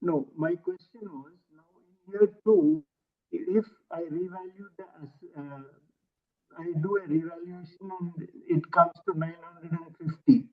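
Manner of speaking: faint, distant voice on a weak mic; barely audible, far from the mic. Real, not acted. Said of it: "it's not audible".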